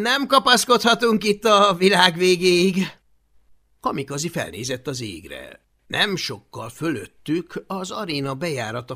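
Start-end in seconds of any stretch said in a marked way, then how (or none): 0.1-3.0 loud, higher shout; shouting boy. Acted. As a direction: call it over the wind.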